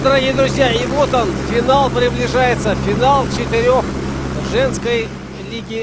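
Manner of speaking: upbeat broadcast opening voice; sports announcer. Acted. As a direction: announce the final warmly and loud.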